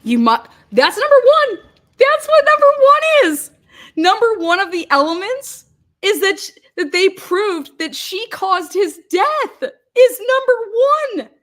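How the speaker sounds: high, loud, incredulous shouting; exasperated outburst. Real, not acted. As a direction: yell it in real outrage, hammering the point. Exasperated rant at played jury instructions.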